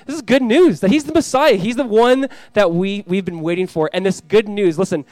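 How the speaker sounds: emphatic preaching. Real, not acted. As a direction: proclaim it to the room, stretching key words. Proclaims good news at force.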